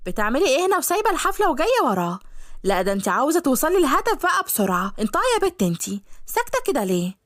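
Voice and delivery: صوت يارا أعلى بكتير وغيران; خطيبة غيرانة بتزعق في الخدامة بصوت عالي؛ يارا الغيرانة بتهاجم طيبة بصوت أعلى; jealous Yara. Acted. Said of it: "جت يارا من وراهم".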